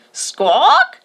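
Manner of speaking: high bright squawk like a macaw; parrot squawk. A narrator's reading. The kite parrot greeting the boys with a squawk. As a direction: screech it like a parrot.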